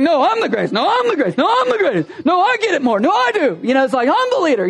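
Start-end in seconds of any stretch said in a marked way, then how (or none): 0.0-3.6 high, mock-childish bickering; one-up each other, pitch swooping higher each time; hammed-up argument over who's best; competitive squabbling. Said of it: "they were pretty competitive". 4.1-4.7 high-pitched mock boast; hammed-up childish bickering; arguing. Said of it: "they would argue about these things".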